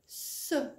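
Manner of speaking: "ce" breathed out with no voice; soft c sound. Shown as a demonstration. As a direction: let "ce" hiss out unvoiced. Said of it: "le C qui fait".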